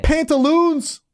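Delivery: very high, drawn-out silly call; playful high-pitched exclamation. Pretend. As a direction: sing it out high and slow.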